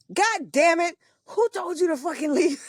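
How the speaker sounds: high, exasperated scolding; scolding sister. Retold or imitated. Spiritual sister scolding her for leaving, high exasperated voice. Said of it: "She's like".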